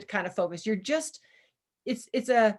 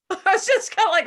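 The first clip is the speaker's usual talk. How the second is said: high, loud laughing burst; 10 semitones higher, 8 dB louder, faster than usual. Real, not acted. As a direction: laugh through the words. Laughing while retelling.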